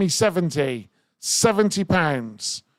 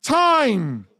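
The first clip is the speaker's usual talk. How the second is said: excited call; 11 semitones higher, much slower than usual. Real, not acted. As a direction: announce the end with a burst. Calling end of the auction.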